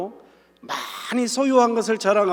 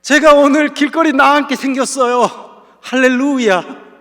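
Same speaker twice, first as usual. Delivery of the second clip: joyful shout of praise; 7 semitones higher, 9 dB louder than usual. Pretend. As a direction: cry out hallelujah as if bad news were good. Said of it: "오늘 식으로 따진다면".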